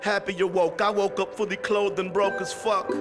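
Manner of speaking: ordinary talk, nothing marked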